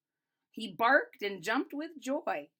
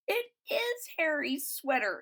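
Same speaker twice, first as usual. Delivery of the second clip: high surprised exclamation; exclaimed; 6 semitones higher than usual. A narrator's reading. Grandma recognizing the sweater, lightly surprised. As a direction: realize it with surprise. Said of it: "exclaimed Grandma".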